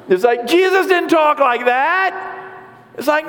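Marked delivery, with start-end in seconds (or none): 0.5-2.1 high, drawn-out cry of disbelief; mock protest. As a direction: protest in exaggerated, high-pitched indignation. Acted protest at the accent.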